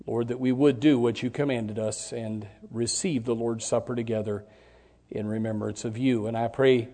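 soft, hushed prayer to close the invocation; quiet prayer. Acted. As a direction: pray it quietly and reverently. Opening prayer before sermon.

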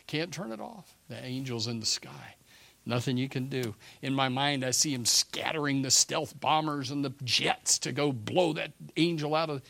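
ordinary talk, nothing marked

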